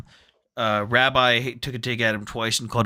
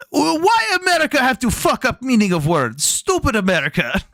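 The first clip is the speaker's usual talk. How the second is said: much higher mock Ukrainian complaint; indignant Ukrainian accent; 10 semitones higher than usual. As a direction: imitate the soldiers griping about America in a Ukrainian accent, higher. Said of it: "their response was".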